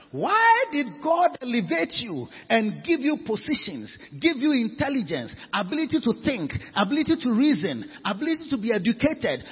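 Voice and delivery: emphatic, driving sermon delivery; forceful preacher's challenge; impassioned preaching. Real, not acted. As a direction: hammer the questions at the congregation.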